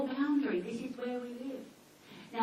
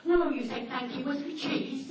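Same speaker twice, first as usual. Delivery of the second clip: higher, louder, laughing dog; cheeky dog voice; 4 semitones higher, 4 dB louder than usual. Retold or imitated. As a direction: play the scheming dog. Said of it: "they go".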